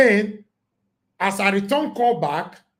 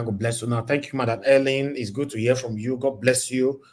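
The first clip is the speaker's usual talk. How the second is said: usual speaking voice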